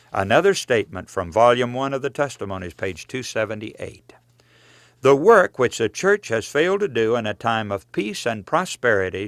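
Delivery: ordinary talk, nothing marked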